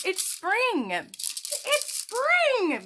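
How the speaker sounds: high, loud carefree grasshopper voice; playful grasshopper. Acted. Grasshopper taunting the busy ants, high and loud. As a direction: tease the hard workers from up high. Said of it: "the grasshopper says".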